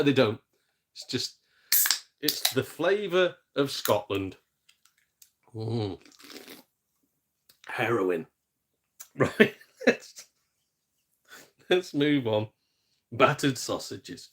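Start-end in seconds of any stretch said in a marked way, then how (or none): none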